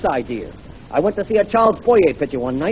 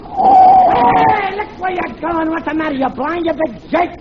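high, angry Brooklyn holler; excited outburst in a Brooklyn accent; 10 semitones higher, 4 dB louder, slower than usual. Acted. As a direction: yell at a driver in thick Brooklynese. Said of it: "talking with a Brooklyn accent".

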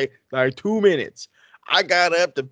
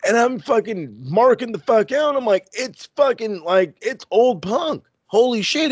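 high, excited marking out; higher-pitched fan squeal of disbelief; 8 semitones higher than usual. Retold or imitated. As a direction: lose it like a fan seeing a hero. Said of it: "I turn and I look".

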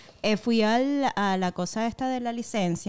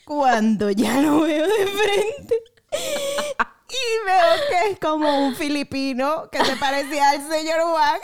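real laughter through the words, slow and higher; laughing while telling; 7 semitones higher, 3 dB louder, slower than usual. Real, not acted. Telling a friend the embarrassing mix-up, laughing through it. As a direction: laugh helplessly at her own blunder. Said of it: "laugh cry".

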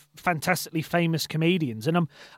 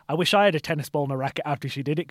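ordinary talk, nothing marked

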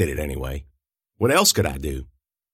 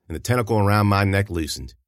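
ordinary delivery

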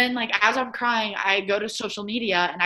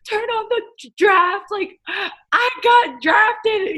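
high, slow tearful sobbing; 14 semitones higher, 5 dB louder, slower than usual. Retold or imitated. Daughter sobbing draft news to mom, high tearful sobbing. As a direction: sob out the news, high and drawn out. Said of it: "sobbing this out".

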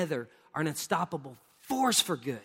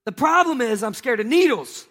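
ordinary talk, nothing marked

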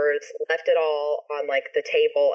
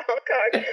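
honking voice; 7 semitones higher than usual. Shown as a demonstration. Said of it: "an authentic, like, klaxon horn".

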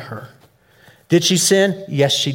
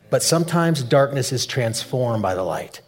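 usual speaking voice

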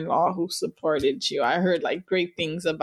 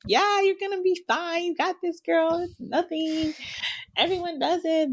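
much higher, breezy fake confidence; mock-casual big-sister pep talk, pitched way up; acting reassured; 10 semitones higher than usual. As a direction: pretend it's all nothing to calm her sister. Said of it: "I would act like".

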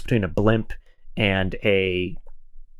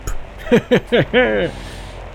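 high, pompous chortle; pompous British laugh; 11 semitones higher than usual. Acted. British officer chuckling at his own joke, pompous high laugh. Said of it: "very pompous, uh, British laugh".

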